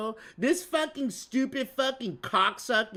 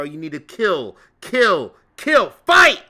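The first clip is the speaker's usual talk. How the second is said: goading battle cry; 9 dB louder than usual. Acted. Egging a Marine on to attack, building to a shout.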